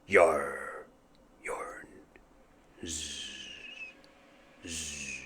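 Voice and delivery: fading into soft snores; drifting to sleep. Acted. Drunk pirate nodding off, sleepy snoring. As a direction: yawn and doze off mid-word.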